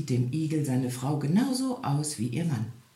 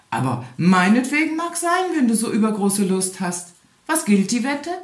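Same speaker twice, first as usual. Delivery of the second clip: higher, louder, cocky hare; boastful hare; 6 semitones higher, 6 dB louder than usual. A narrator's reading. The hare taunts the hedgehog over the bet: higher, louder, cocky. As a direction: toss off the bet like a show-off.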